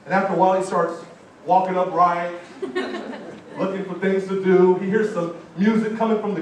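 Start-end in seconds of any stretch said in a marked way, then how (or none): 2.4-3.5 breathy high laugh; a quick unvoiced chuckle; a short airy laugh. Real, not acted. Preacher laughs at own joke.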